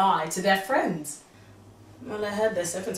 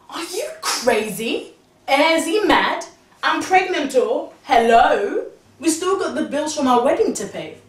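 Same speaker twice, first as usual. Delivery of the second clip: indignant outburst; 4 semitones higher, 8 dB louder than usual. Retold or imitated. Outraged at husband's calm.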